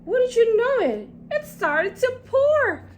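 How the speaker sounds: high, loud, fed-up cry; wouldn't you know it, groaning; exasperated exclamation. A narrator's reading. Narrator's dismay at the rain.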